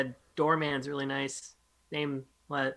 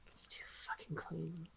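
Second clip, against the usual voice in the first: barely audible slow whisper; hushed in-character grumble, all breath; disgusted whisper; 20 dB quieter, slower than usual. Acted. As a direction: whisper it slowly under your breath. Character looks round, muttering to self.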